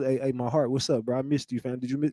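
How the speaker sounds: hushed mocking "my heart" greeting; mock sweet talk. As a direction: tease him as an overly sweet bro. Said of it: "don't talk".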